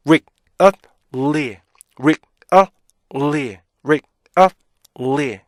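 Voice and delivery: "rick, up, 리" as separate stopped syllables; three-beat breakdown. Shown as a demonstration. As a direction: chant really in three detached pieces. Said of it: "이중 모음을 K로 나눠요".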